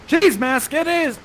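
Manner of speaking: high, bright reveal of the pick; excited announcement. Real, not acted. Host excitedly announcing the volunteer's pick. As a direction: announce the choice with a flourish.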